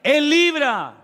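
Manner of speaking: grito agudo de predicador en el clímax; proclamación gritada. A narrator's reading. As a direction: clama con toda la fuerza. (el pastor clama el versículo a la congregación, gritando)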